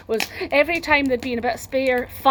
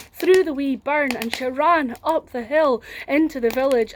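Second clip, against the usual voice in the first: plain reading voice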